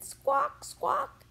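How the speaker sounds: high, loud parrot squawk. A narrator's reading. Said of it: "She said".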